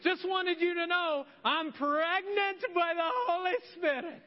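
high, loud, breezy blurt of the news; a teen casually dropping a bombshell; teenager's announcement. Retold or imitated. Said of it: "a teenager coming to you ... and saying".

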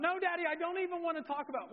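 high, fast wailed refusal; imitated crying child. Daughter refusing Daddy's talk, mock crying. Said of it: "and she's crying".

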